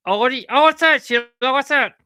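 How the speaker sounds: high, loud burst of puppet excitement; excited puppet. Acted. Puppet reacts to something new.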